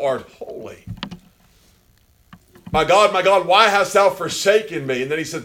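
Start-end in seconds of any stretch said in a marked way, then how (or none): 2.7-5.0 raised, loud scriptural plea; impassioned preaching. Acted. Preacher voicing the forsaken cry to God, impassioned.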